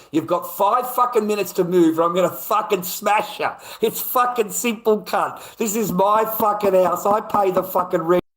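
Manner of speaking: high, loud, furious outburst. Retold or imitated. Man ordering the intruder out of his house, furious shouting. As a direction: shout him out of your house, hammering each swear word. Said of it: "I was so fucking angry".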